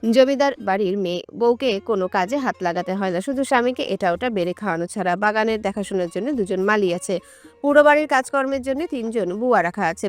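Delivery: ordinary delivery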